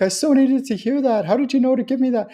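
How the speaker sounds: high-pitched, overwhelmed thanks; imitated tearful gratitude. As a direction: imitate someone amazed and moved. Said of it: "they're often, like, crying".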